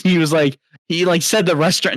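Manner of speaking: normal talking voice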